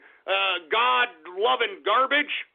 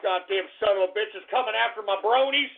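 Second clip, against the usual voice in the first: ordinary talk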